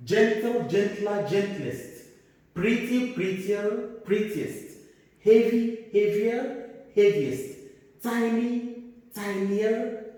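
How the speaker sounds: classroom drill. Shown as a demonstration. Teacher leading class repetition, chanted drill. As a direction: drill the forms so the class repeats.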